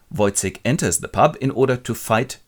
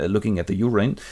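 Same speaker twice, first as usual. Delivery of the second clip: usual speaking voice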